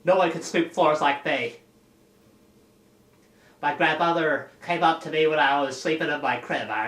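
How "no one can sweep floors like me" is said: higher, louder cocky introduction; Edward Casey character. Pretend. Edward introduces himself to the crowd, loud and cocky. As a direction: announce yourself like a proud job applicant. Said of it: "My name's Edward Casey".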